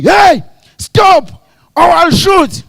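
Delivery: high, loud mock threat; acted gunman's shout; shouted warning. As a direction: yell it after a running man. Acting out shouted chase warning.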